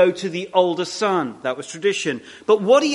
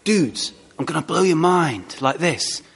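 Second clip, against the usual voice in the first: lightly voiced intense friend; a hint of an eager buddy promising a revelation; mild imitation of a keen fisherman friend; 3 semitones lower than usual. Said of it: "he'd be going".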